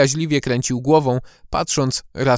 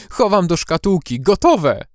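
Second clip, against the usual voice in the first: loud, high and thrilled; excited; 6 semitones higher, 5 dB louder than usual. Acted. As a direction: burst out with glee. Elf thrilled with his find.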